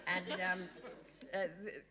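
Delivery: normal talking voice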